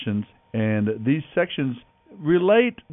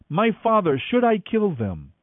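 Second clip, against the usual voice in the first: mild shout; 8 semitones higher than usual. A narrator's reading. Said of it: "he shouted to Elisha".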